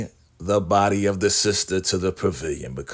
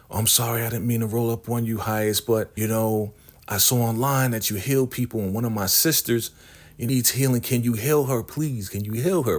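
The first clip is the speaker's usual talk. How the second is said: higher-pitched, mildly imploring retold plea; pleading; 4 semitones higher than usual. Sister asks the highest to heal someone, mildly pleading. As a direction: voice a polite, apologetic request, pitched up. Sister begs a healer for help.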